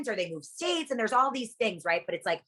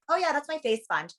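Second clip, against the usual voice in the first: joking; 10 semitones higher than usual. Retold or imitated. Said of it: "I always joke around".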